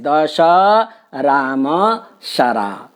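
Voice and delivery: दश, राम, शरा each with a pause; separated words. Shown as a demonstration. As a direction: pause between each word. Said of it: "मशरा से नहीं".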